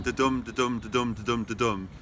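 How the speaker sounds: DA-DUM in a steady beat, each DUM stressed; iambic beat. Shown as a demonstration. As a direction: chant da-dum evenly, weak then strong, sinking in pitch. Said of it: "five I ams per line".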